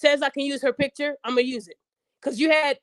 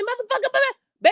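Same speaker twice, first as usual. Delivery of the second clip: much higher mock rant of an angry woman; woman yelling; 11 semitones higher than usual. Mocking a woman yelling at her man, high and heated. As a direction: rattle it off like a furious girlfriend mid-argument. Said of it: "If you ever seen a woman yelling".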